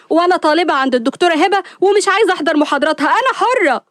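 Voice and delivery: high-pitched indignant protest; defiant. Acted. Sister argues back at her brother.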